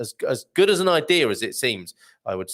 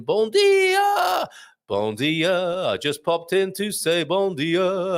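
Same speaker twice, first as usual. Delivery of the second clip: high, stretched sing-song call; drawn-out greeting; 6 semitones higher, much slower than usual. Acted. Reading out Andy's greeting to the listeners, sing-song call. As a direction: call it out long and high, then ease down.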